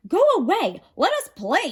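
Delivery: high, loud thundering command. A narrator's reading. Zeus drives the Titans off, a loud thundering command. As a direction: bellow it from the mountaintop. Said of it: "he thundered".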